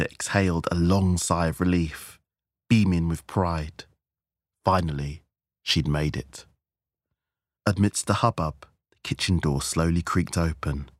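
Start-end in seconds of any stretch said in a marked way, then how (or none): none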